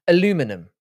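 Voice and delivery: "Aluminum" stressed on LU, four syllables; American aluminum. Shown as a demonstration. Streamer saying it for chat, "Aluminum" stressed on LU. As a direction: say "Aluminum" as a-LU-mi-num. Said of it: "aluminum and aluminium are different words".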